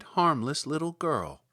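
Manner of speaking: plain reading voice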